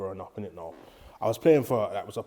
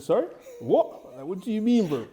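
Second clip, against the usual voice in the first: incredulous reply; 9 semitones higher, 6 dB louder than usual. Retold or imitated. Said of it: "I said".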